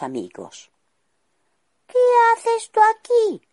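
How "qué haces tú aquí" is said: very high, loud squeaky gnome challenge; hostile gnomes. Acted. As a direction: demand it like suspicious little miners.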